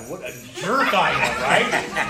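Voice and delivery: amused, incredulous outburst; self-mocking laughter. Real, not acted. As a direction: burst out laughing at yourself. Laughing at himself while retelling.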